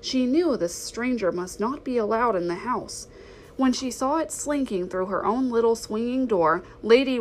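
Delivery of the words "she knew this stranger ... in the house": ordinary delivery, nothing marked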